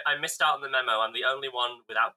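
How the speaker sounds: usual speaking voice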